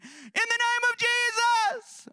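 put-on high, slow, loud children's voice; high kids' cry. Kids facing Gregorio, high childish proclamation. Kids command in Jesus' name.

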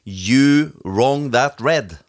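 very slow, deliberate English; measured, drawn-out sticker reading. Acted. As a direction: let each scrambled word sit. Reads sticker text aloud.

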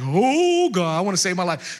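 high, loud wail on the first word, then easing; hammed-up plea of a doomed man; mock panic. Haman suddenly fearing for his life, mock panicked wail.

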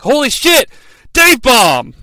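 high, loud shout of delight; excited outburst. Retold or imitated. Watching WrestleMania, shouting at the big slam, thrilled. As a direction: yell it like you can't believe your eyes. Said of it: "and I'm like".

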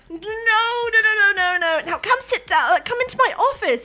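high, loud string of panicked refusals; flustered Tesh. Acted. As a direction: hurriedly shut it down in a high voice. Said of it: "And she's like".

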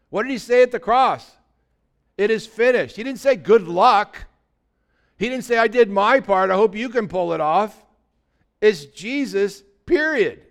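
loud, high fervent preaching; full-force preaching. Acted.

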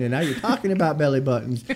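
ordinary talk, nothing marked

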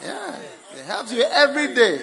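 excited yes. Real, not acted. Preacher exclaiming joyfully to the congregation. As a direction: jump high on yeah, then laugh it out loud.

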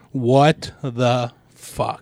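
slow, stunned and baffled; drawn-out disbelief; baffled what-the-fuck. Acted. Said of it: "that feeling is".

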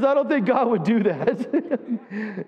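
slow words broken by laughter; slowed, laughing retelling of her punchline. Retold or imitated. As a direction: barely get it out for laughing. Punchline retold amid laughter.

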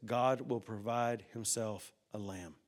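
soft, slow and weighty; solemn quiet line. Acted. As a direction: let every word land softly. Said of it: "listen very carefully what he says".

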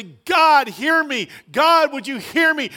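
high, loud cry to God; loud pleading prayer. Retold or imitated. A believer crying out loud, begging God to hear. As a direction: call out to God, pleading hard. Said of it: "we come before the Lord and we say".

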